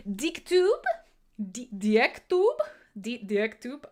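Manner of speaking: stumbling over DickTube with a questioning rise; mangled name. Real, not acted. Host greeting a new viewer, unsure how to say DickTube. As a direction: try out DickTube haltingly, each attempt rising. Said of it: "I'm gonna ruin your name".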